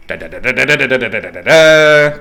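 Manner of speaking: mock drumroll on "da"; imitated spinning wheel. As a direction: voice the wheel's clicking, rising to a peak.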